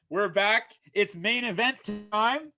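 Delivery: lively ringside commentary, calling every move; wrestling play-by-play. Acted. Host calling his own wrestling show, energetic play-by-play. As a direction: call the match like a TV announcer.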